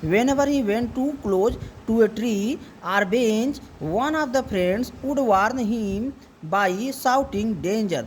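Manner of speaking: very slow, every word spaced; slow reading. Shown as a demonstration. Teacher slowly reading the passage aloud to a pupil. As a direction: read the sentence slowly so the child follows. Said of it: "अब इसका मतलब देखे ना".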